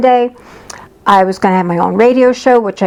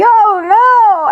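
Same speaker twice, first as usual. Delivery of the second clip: mock wail; 12 semitones higher than usual. Client wailing at news of a baby, hammed-up despair.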